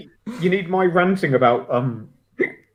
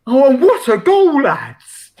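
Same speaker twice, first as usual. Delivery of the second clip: higher, louder mock commentary yell; imitating an excited goal call; 10 semitones higher, 6 dB louder, slower than usual. Mimicking Charlie's goal call to the lads, excited. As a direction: ham up a goal celebration call. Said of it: "We got Charlie going".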